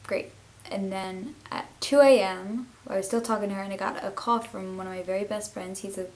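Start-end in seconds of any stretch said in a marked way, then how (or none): 0.1-0.3 dry, sarcastic "great" said twice; mock enthusiasm, mildly louder. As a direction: roll your eyes on it. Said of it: "And she was like".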